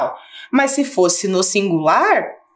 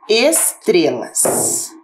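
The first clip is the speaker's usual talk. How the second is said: estrelas said slowly and clearly, syllable by syllable; slow careful word; 4 semitones lower, much slower than usual. Shown as a demonstration. Teacher presenting a word to pupils, slow and clear. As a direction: draw out estrelas for the class. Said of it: "as palavras que eu selecionei foram".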